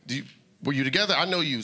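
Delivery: ordinary talk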